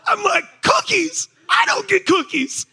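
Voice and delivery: high, loud jealous yelp; indignant outburst. Retold or imitated. Husband protesting his wife's cookie plan, high indignant yelp.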